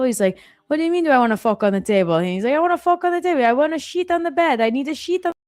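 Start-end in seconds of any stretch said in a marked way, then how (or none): 0.7-2.2 high, fast, indignant cartoon Italian; mock-outraged Italian, rushed and louder; imitated Italian accent. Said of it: "speaking Italian". 2.5-5.3 high-pitched, exasperated cartoon Italian; imitated Italian accent. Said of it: "speaking Italian".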